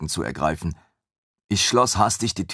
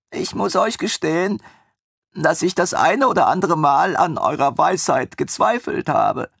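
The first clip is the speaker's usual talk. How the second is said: high, gushing voice of the eager servant; devoted young servant; 12 semitones higher, 4 dB louder than usual. A narrator's reading. Armin gushes to his former master about his happy lioness, high and eager. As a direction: pour out relief and adoration, much higher.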